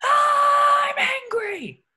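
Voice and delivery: high, loud, stretched-out scream; angry yell. Acted. SpongeBob obeys his boss's goading, long angry scream. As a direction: draw it out as a furious war cry. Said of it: "Get angry".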